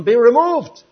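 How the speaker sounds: high, loud command to the mountain. Retold or imitated. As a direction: order the mountain to go. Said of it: "you could say to the mountain".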